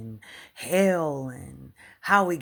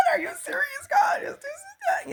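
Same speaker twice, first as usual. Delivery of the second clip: crying disbelief. Retold or imitated. To God while healing, a tearful high disbelieving outcry. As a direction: cry out like you can't believe what you're being shown.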